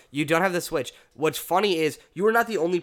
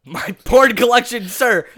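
barked army recruit replies; drill sergeant; 8 semitones higher, 9 dB louder, slower than usual. Pretend. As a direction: shout it like a cadet. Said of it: "keep this up with this bit".

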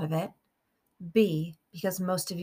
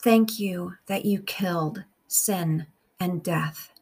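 slow, measured meditation with long pauses; guided prayer; slower than usual. Real, not acted. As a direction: pray phrase by phrase, unhurried, leaving room to repeat.

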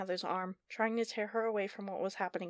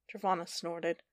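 usual speaking voice

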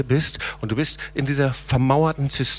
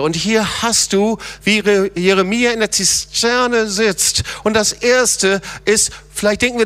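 fervent preaching; 9 semitones higher, 4 dB louder than usual. Acted. Pastor urging the church to cry to the Lord, fervent preaching. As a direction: preach it, calling them out of the pit. Preacher rising to full force.

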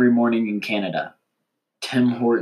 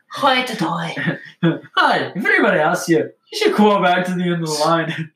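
playful high voice; 6 semitones higher than usual. Acted. Playful put-on voice, joke delivery.

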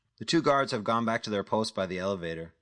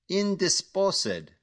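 very slow, syllable by syllable, much higher; slow, broken into parts; 11 semitones higher, 4 dB louder, much slower than usual. Acted. Caller mocking Jack's word, slowly splitting it up. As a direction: drag out Indisposed, each part split apart. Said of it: "the voice says slowly, breaking the word into its parts".

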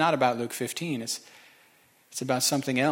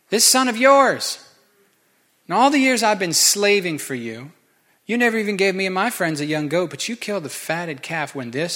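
higher, louder bitter complaint; bitter outburst; 3 semitones higher, 4 dB louder than usual. Acted. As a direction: spit out son of yours with contempt. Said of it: "doesn't even call him my brother".

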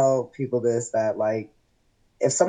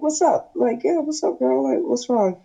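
retold greeting, pitched way up; high-pitched reply; 12 semitones higher than usual. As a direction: answer her in a high, light pitch. Retold greeting to upset coworker.

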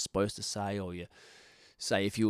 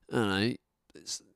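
ordinary delivery

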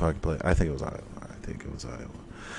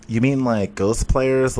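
ordinary delivery

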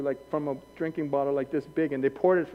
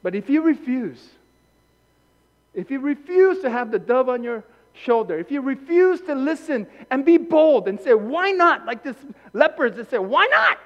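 rising, louder sermon; preaching; 9 semitones higher, 7 dB louder than usual. Real, not acted. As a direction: urge them boldly. Preaching, building.

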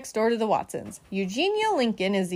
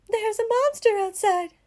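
frightened report; 10 semitones higher than usual. A narrator's reading. Frightened Baby reports monster.

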